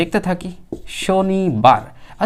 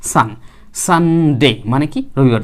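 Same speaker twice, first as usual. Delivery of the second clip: normal talking voice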